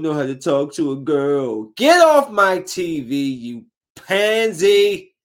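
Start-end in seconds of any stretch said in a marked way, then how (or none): 1.8-5.1 drawn-out, higher and louder scolding at the screen; exasperated rant. Real, not acted. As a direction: shoo the wimp off your TV. Ranting at a softie TV character.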